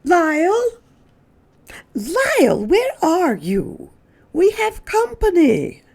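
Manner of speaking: high, searching call; calling again. Acted. Mrs Prim calls the hiding Lyle again, high and searching. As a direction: call for him, wondering where he went. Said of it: "Missus Prim called again".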